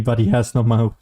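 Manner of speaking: usual speaking voice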